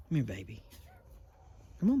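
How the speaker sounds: ordinary talk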